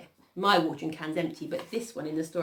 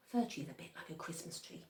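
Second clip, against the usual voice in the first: ordinary delivery, nothing marked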